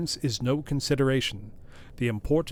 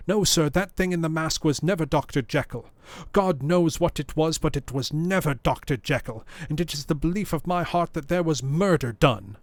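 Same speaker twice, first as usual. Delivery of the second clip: loud, higher, pleading butler; impassioned outcry; 7 semitones higher, 4 dB louder than usual. A narrator's reading. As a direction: insist to the lawyer, near shouting. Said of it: "cried Poole".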